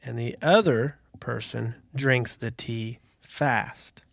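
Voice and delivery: slow, deliberate riddle read. Acted. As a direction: read it out slowly for suspense. Reads the riddle aloud slowly.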